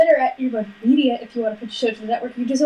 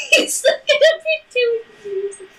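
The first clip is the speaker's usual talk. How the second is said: laughing; 12 semitones higher than usual. Real, not acted. Cracking up over Eli.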